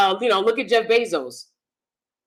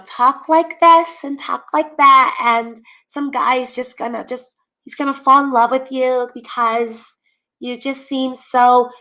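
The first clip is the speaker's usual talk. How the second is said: high, put-on girly voice; made-up baby voice; 6 semitones higher than usual. Shown as a demonstration. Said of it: "This made-up voice".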